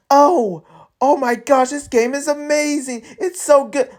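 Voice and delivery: high, gushing mock praise for a game; fake gushing. Streamer mocking his own paid review, high fake gushing. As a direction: gush like a paid sponsor pretending to love it. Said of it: "I will pretend".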